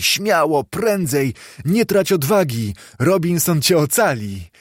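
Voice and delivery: lively, rallying cries; urging on. A narrator's reading. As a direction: cheer a runaway on eagerly. Cheering on fleeing storybook Friday.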